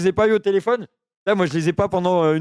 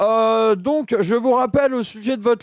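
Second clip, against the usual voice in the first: cheeky delivery man voice. Acted. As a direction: play an absurd chirpy delivery man. Second prank call, delivery man.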